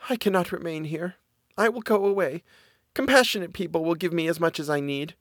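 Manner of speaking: put-on high, soft girl's voice; gentle daughter taking her leave; miller's daughter, resolved.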